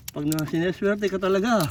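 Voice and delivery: ordinary talk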